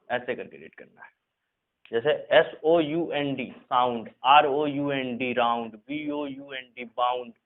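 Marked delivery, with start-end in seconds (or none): none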